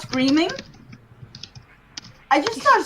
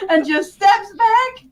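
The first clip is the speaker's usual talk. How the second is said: ordinary talk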